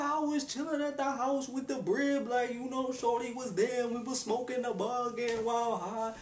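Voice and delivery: put-on high-pitched teen chatter; teen girl gossip voice. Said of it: "talking their usual talk".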